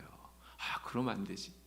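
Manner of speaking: breathy private whisper; whispered to himself. Retold or imitated. Young man rejecting his own wish idea, hushed whisper. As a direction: mutter the thought under your breath.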